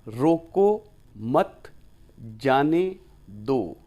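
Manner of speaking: very slow, each word set apart. Shown as a demonstration. Teacher showing students four bare words, spaced out slowly. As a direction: say it slowly, giving no grouping away. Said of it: "मैंने इसको कैसे बोल दिया".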